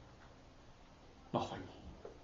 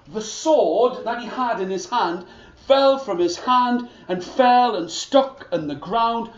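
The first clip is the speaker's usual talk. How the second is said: ordinary delivery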